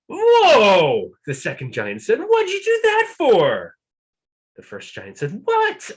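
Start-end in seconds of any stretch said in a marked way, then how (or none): 0.1-1.1 loud, high startled yell; second giant woken by a rock, shouting. Acted. 2.2-3.8 very high, loud indignant whine; second giant accusing his brother in a squeal; high squeaky giant. Acted. 5.4-5.9 very high, loud yelp of denial; first giant denying it, squealing; squeaky giant. Acted. Giant denies.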